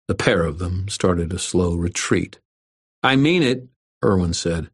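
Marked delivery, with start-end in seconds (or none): 3.0-3.7 firm. A narrator's reading. Irwin warns bullies.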